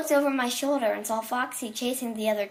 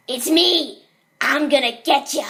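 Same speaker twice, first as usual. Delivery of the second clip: loud, high menacing catchphrase; sudden theatrical monster shout; Foxy; 3 semitones higher, 9 dB louder than usual. Acted. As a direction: burst out with it as the scare ending. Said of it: "Foxy always said".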